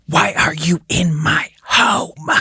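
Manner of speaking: slow spooky whisper; ghostly whisper. Retold or imitated. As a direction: whisper it like an unseen presence. Reading ghost answer options.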